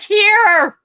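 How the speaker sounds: high and loud; emphatic. Retold or imitated. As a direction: hit it hard. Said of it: "He's like".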